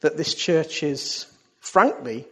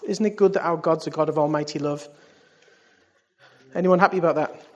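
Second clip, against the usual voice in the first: ordinary talk, nothing marked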